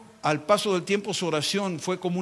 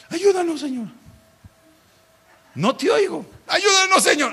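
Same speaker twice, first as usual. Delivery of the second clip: much higher, louder pleading shout building up; fervent cry; 11 semitones higher, 5 dB louder than usual. Retold or imitated. The church answering the Lord, crying out ever louder. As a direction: cry out to the Lord with all your strength.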